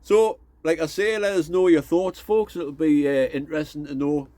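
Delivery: chatty put-on Geordie accent. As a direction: talk like a Newcastle local. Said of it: "I'm sick of trying to do that Geordie accent".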